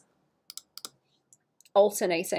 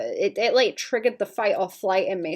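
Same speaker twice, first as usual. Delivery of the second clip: ordinary delivery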